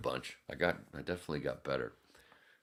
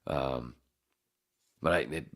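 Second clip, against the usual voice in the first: ordinary talk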